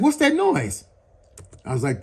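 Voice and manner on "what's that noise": friend's quote. Retold or imitated. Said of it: "He's like".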